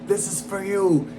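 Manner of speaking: slow mock-tearful victory cry; imitated crying. Champion to his city after winning, mock crying. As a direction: imitate a champion choked up with tears. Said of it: "And he was crying".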